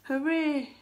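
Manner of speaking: high, loud storybook cheer. A narrator's reading. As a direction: cheer like rescued fish. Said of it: "cheered the fish".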